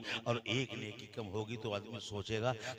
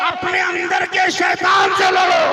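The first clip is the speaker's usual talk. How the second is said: booming high shouted sermon line; fiery preaching; 23 dB louder, slower than usual. Acted. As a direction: thunder it at the crowd.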